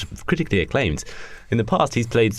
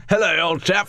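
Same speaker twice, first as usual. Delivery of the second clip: higher, put-on English gent; hammed-up English greeting; mock English accent; 6 semitones higher than usual. [name] answers his friend in mock English accent. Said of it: "Really, it's just English".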